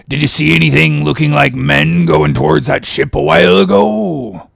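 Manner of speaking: high, loud cracked-stranger voice; Elijah's voice. Acted. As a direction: press them with an eerie question. Elijah accosts them, put-on voice.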